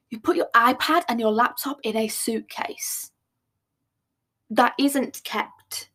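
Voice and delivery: ordinary talk, nothing marked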